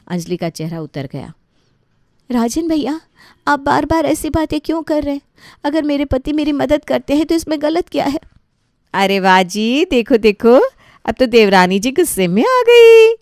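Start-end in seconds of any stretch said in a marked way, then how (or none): 2.3-8.2 high, loud hurt protest; upset protest. Acted. Bride protests to brother-in-law. 8.9-13.1 loud, higher mock delight; sister-in-law mocking the angry bride, loud; mocking jeer.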